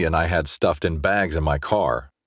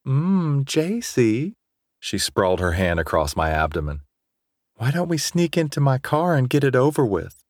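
put-on higher, breathy-free teasing girl; 7 semitones higher than usual. A narrator's reading. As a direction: coax him sweetly, higher pitched. Nicole voiced in story.

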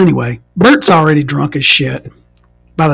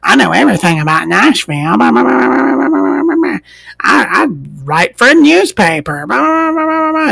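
much higher, babbling blah-blah know-it-all; mock know-it-all lady; 8 semitones higher than usual. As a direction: parody a pompous hipster lady. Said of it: "she's like".

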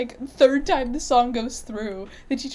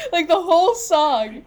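slow, loud, crying with laughter; barely holding it together from laughing; laughing through it; 7 semitones higher, 8 dB louder, slower than usual. Real, not acted. Laughing while telling a funny story.

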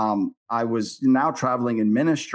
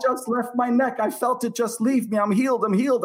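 higher, a hint of a tearful healed woman; 10 semitones higher than usual. Healed lady telling the preacher, lightly voiced and tearful. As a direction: lightly voice her emotional joy. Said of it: "starts crying".

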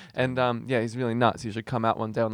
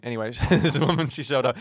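usual speaking voice

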